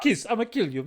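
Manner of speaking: Italian mobster voice; thick New York mobster accent; Long Island accent. Retold or imitated. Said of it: "that Long Island accent".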